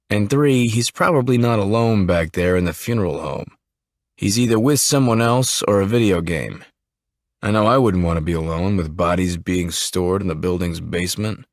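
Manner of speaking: plain reading voice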